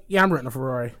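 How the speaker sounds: imitating Marty. Friend Marty announcing his Ferrari rental, low mock voice. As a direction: drop the voice low and boast offhandedly about the Ferrari. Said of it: "Marty came in the room and was like".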